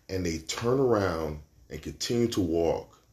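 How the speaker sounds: ordinary talk, nothing marked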